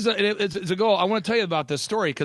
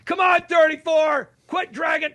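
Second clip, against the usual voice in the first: heckling shout; 10 semitones higher, 3 dB louder than usual. Retold or imitated. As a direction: yell from the bleachers at the kid. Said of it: "crazy uncle's up there going".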